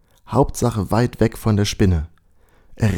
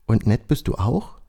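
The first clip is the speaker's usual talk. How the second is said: even more astonished; 2 semitones higher than usual. A narrator's reading. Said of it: "fragt Timon noch erstaunter".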